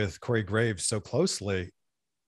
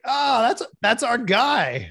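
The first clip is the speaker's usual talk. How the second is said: delighted high-pitched recognition; thrilled, louder and slowed down; excited; 12 semitones higher, 6 dB louder, much slower than usual. Retold or imitated. Excited recognition on seeing him.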